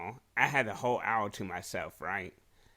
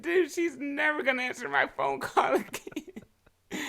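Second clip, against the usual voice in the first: much higher, laughing through it; high mocking voice; 6 dB louder than usual. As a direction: play it goofy. Retelling drunk friend's remark.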